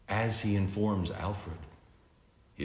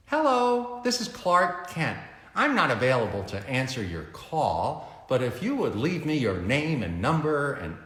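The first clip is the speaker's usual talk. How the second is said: higher, friendly recorded greeting; Clark Kent's message; 6 semitones higher, 4 dB louder than usual. A narrator's reading. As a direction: chirp the answering machine message. Clark's answering machine message.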